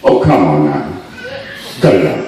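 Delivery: laughing, dismissive mock of folks who don't believe; scoffing doubters. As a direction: put on a deep scoffing neighbour. Doubters scoffing at his mother.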